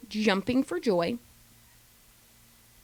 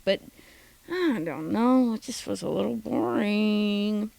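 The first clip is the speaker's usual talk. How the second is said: usual speaking voice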